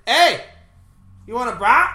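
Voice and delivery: high, loud Wisconsin greeting; Wisconsin accent. Retold or imitated. Wisconsinite offering brats to whoever walks in, mock Wisconsin accent. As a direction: holler it like a keen Wisconsinite. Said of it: "they're like".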